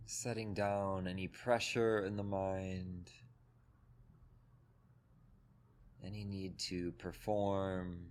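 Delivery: calm, even meditation voice with long pauses; guided meditation. Acted. Teacher leading a group through breath and body, guided meditation. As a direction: guide them gently into the body, leaving space between phrases.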